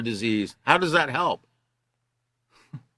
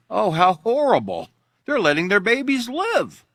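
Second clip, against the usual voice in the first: mock shock, pitched higher; sarcastic horror; 5 semitones higher than usual. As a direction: fake outrage dripping with sarcasm. Sarcastic reaction to article.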